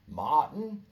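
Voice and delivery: loud rising call of Martin; called out name. Acted. Comic calling out Martin to the crowd.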